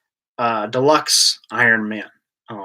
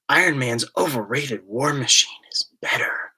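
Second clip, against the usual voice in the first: whisper; 4 dB quieter than usual. Pretend. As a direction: read the chat line in a whisper. Reading a chat message.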